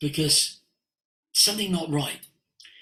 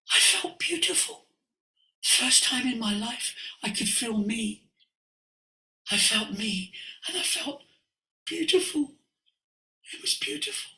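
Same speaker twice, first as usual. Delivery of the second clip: high, halting voice after crying, imitated; tearful awe; 9 semitones higher, 3 dB quieter than usual. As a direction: speak as her, overcome, with long pauses. Acts woman speaking after crying.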